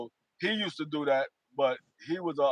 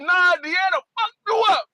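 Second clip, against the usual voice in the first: teasing high retort; 6 dB louder than usual. Retold or imitated. Teasing reply to cousin.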